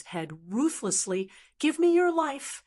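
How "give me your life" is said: high-pitched battle cry; narrated attacker's high, ruthless demand; fierce attack cry. A narrator's reading. Fighter slashing at his enemy's head.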